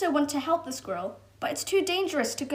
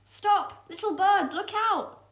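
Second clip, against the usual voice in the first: high, louder alarm call; cat warning the bird; urgent warning shout; 9 semitones higher, 4 dB louder than usual. Acted.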